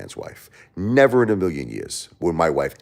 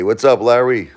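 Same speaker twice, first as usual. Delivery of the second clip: normal talking voice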